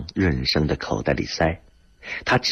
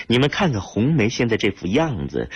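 usual speaking voice

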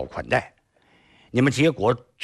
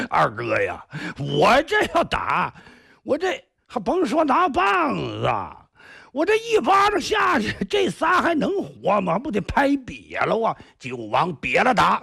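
booming boast; 6 semitones higher than usual. Acted. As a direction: boom it out, swaggering. Big man boasts of his slap.

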